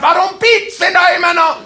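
high, loud laughing yell; mock yell. Man in the back seat yells about the beeping, high and loud. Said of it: "ich hab brüllt ohne Ende".

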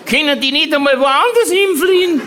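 put-on very high, indignant old aunt, fast and laughing; Tante Mari nachgemacht. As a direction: squeal the aunt's outrage. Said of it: "weil die Tante Mari gesagt hatte". (sehr hohe, empörte Tantenstimme, lachend)

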